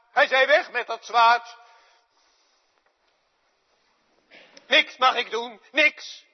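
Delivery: loud, high, impassioned outcry; Peter's anguish. Acted. Preacher giving voice to angry Peter, high loud outcry. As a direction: pour out Peter's frustration at full preaching force.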